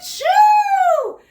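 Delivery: high loud sneeze sound; loud fake sneeze. George sneezing again.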